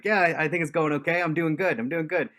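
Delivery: grinning ear to ear, trying to play it cool; giddy, grinning. Retold or imitated. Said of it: "I'm smiling from ear to ear. I can hardly control it".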